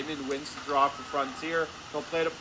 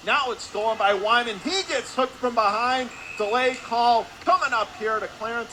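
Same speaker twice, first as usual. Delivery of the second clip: raised, louder call of a sudden turnover; excited play-by-play; 7 semitones higher, 5 dB louder than usual. Real, not acted. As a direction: lift the voice as the play breaks. Calling steal and penalty.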